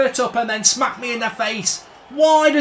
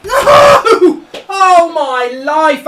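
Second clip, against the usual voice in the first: very high, loud laughing shriek of relief; laughing scream; 6 semitones higher, 11 dB louder, much slower than usual. Real, not acted. Gamer shrieking with laughter as the striker misses, relieved.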